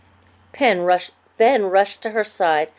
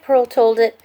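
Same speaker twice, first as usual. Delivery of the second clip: ordinary delivery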